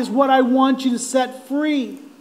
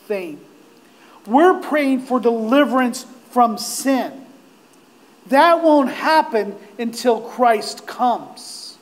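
usual speaking voice

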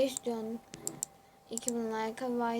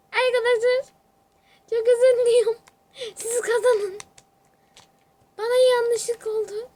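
high, loud gloating apology; loud excited; 8 semitones higher, 10 dB louder than usual. Real, not acted. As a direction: shout it out, thrilled. Mock apology after winning.

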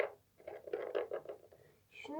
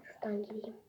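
whispering; 3 semitones lower, 14 dB quieter, faster than usual. Real, not acted.

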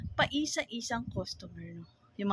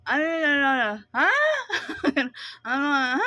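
louder, pressing "ano, ha?"; impatient asking; 2 semitones higher, 6 dB louder, faster than usual. Retold or imitated. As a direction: demand an answer from a mumbler, louder. Owner straining to hear customer.

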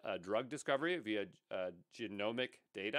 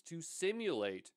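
SIMULATE drawn out and raised in pitch; emphasis on SIMULATE; 6 semitones higher, slower than usual. Real, not acted. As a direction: lean slowly on the word simulate. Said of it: "create a large emphasis on the word simulate".

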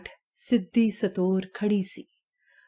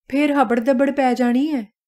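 higher, anxious warning; worried, flustered; 4 semitones higher, 3 dB louder than usual. A narrator's reading. Wife fearing a rainy-night scramble, worried. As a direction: fret about the coming scramble. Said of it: "ਫਿਕਰ ਨਾਲ ਘਾਬਰਦੀ ਸੀ".

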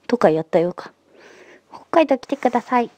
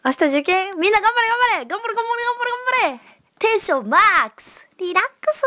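cheering on; 10 semitones higher than usual. Real, not acted. Cheering viewers before exam.

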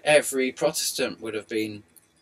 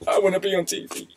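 puppy's voice; 6 semitones higher than usual. Retold or imitated. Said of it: "Little puppy says".

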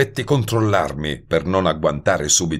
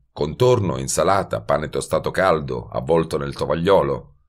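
ordinary delivery

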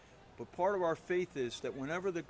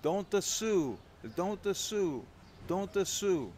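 higher and louder, urgent begging; pleading old woman; 6 semitones higher, 6 dB louder, much slower than usual. Retold or imitated. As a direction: beg him over and over not to sue. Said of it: "leans over at me and says".

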